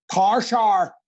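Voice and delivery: "power shower" squashed to "par shar", Northern Irish; mock Northern Irish vowels, drawn out; Northern Irish accent. Shown as a demonstration. Actor teasing Irish friends, Northern Irish "par shar". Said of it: "in Ireland, it's, uh, it's power shower".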